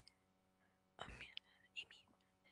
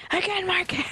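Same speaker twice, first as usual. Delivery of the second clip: higher, exasperated outburst; frustrated; 9 semitones higher than usual. Real, not acted. Gamer frustrated at the game, exasperated. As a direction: throw up your hands.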